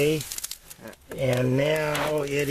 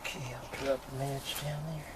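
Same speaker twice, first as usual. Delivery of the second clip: whispering; 8 dB quieter than usual. Real, not acted. Lighting the stove, hushed.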